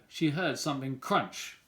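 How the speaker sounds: usual speaking voice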